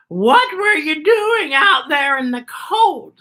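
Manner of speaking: put-on high, slow, tender old woman voice; a caring woman scolding gently; Beatrice's voice. A narrator's reading. Beatrice to Z, worried about the cold. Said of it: "said Beatrice".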